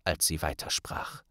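ordinary delivery, nothing marked

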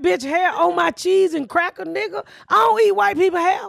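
outraged guest ranting about hair in the food; retold outrage. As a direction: let the disgust boil over. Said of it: "And I'm like".

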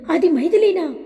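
put-on surprised call to the girl at the car; amazed loud call. A narrator's reading. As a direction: shout her name in disbelief across the lot. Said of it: "ఆశ్చర్యపోతూ గట్టిగా పిలుస్తాడు".

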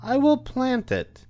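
high, slow hen voice; a small hen musing over a find; Little Red Hen. A narrator's reading. Hen finding the wheat, speaking in a high, slow voice. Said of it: "said the Little Red Hen".

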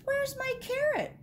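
very high-pitched little rabbit voice; questioning rabbit. A narrator's reading. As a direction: ask it high, as a story-time rabbit. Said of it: "Rabbit asked".

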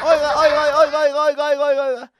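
loud repeated hey; teacher catches student eating in class; teacher calling out. Retold or imitated. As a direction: call sharply to the pupil.

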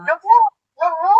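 falter, stuck on one word; flustered stammering. Acted. Son-in-law caught off guard by the family, flustered stammering. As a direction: stammer in confusion, groping for the rest. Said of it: "ఏమిటి మాయ గారు అలా తడబడుతున్నారు".

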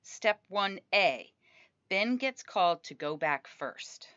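usual speaking voice

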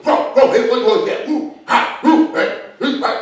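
yappy excited dog calls; dog barking voice. Pretend. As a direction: bark like a guard dog.

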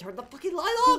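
high, urgent yell at the player; exasperated yell. Real, not acted. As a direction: yell it as a frantic plea. Urging the on-screen player, exasperated.

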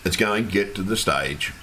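ordinary delivery

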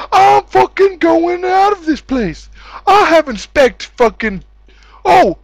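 usual speaking voice

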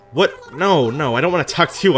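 usual speaking voice

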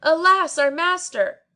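high, loud lament; wailing shout. A narrator's reading. Servants shouting grief for their master, high and loud. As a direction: wail it out.

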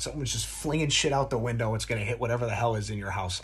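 ordinary talk

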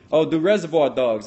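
ordinary delivery

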